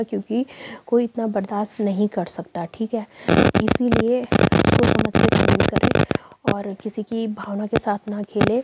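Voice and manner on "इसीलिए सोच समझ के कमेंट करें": louder, slow, pressing each word; loud slow appeal to think before commenting; emphatic plea. Real, not acted. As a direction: plead firmly with commenters.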